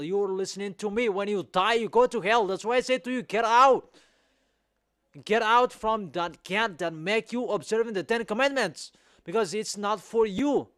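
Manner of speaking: loud, high preaching, building to a shout; heated rebuke. Real, not acted. Preacher ordering lawbreakers out, loud heated rebuke. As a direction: shout the warning, peaking on "get out".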